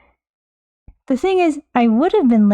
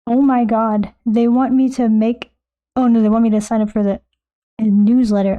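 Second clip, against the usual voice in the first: normal talking voice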